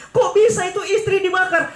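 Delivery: high, incredulous question; preacher asking, aghast; shocked question. Retold or imitated. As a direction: ask in horrified disbelief. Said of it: "Saya bilang".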